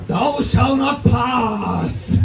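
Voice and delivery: very slow, booming wizard decree; annoyed Gandalf. Retold or imitated. Comedian as annoyed Gandalf stopping traffic, slow booming command. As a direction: thunder it like a fed-up wizard blocking the bridge. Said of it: "transforming into very annoyed Gandalf".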